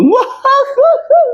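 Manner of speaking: high, mock guffaw; imitating rowdy laughing; loud laughter. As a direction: laugh out loud too much. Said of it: "Teriak-teriak".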